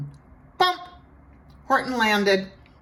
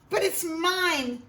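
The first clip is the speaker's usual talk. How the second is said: screamed; 10 semitones higher than usual. A narrator's reading. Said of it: "screamed the bird".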